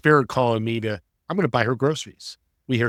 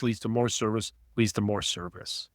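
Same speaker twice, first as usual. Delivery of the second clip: soft trailing repeat of leads to more service; fading away; 3 semitones lower, 6 dB quieter than usual. Shown as a demonstration. Repeating the message to listeners, fading softly. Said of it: "repeated phrase, fading".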